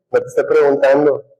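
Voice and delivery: ordinary talk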